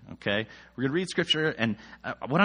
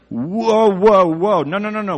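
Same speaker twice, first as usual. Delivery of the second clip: higher, louder panicked protest; alarmed objection; 7 semitones higher, 10 dB louder than usual. Retold or imitated. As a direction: wave them off in alarm, loudly.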